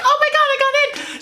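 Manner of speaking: high, squealy mock joy, repeated; squealing contestants.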